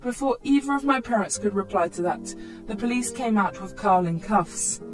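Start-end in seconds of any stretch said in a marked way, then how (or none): none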